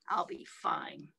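soft unvoiced self-comfort; whispered brave words; whispered reassurance. Shown as a demonstration. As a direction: whisper it to yourself.

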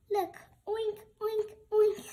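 high, loud excited pig oinks. Acted. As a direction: squeal it out like a thrilled piglet. Piggy shows pigs oinking.

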